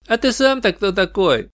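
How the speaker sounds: put-on high, dismissive young voice; cool, curt goth teen; goth kid voice. Goth kid asks detective.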